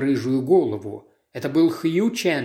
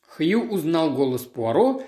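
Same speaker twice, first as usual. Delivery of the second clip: ordinary delivery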